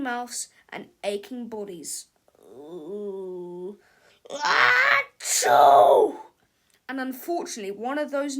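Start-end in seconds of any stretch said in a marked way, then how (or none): 2.4-3.8 low queasy ooh before a sneeze; sickly groan. Acted. Melvin feeling a sneeze coming, groaning. 4.3-6.1 explosive shouted achoo, much higher and louder; huge sneeze. Acted. Sick Melvin sneezing all over the classroom, explosive.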